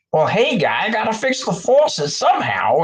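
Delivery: mock Massachusetts guy in a Boston accent; Massachusetts guy impression. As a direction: do a hammed-up Boston handyman shouting. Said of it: "just a Massachusetts guy".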